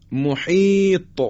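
محیط drawn out, final ط echoing; slow recitation demo. Shown as a demonstration. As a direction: stretch محیط, bounce the ط. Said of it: "حرف طا".

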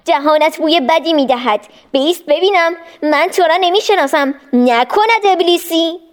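lightly voiced wary boy; suspicious boy. A narrator's reading. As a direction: call out the stranger's lie. Boy Ismail confronts stranger.

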